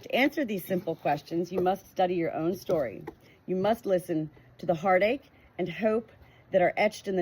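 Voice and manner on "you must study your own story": ordinary delivery, nothing marked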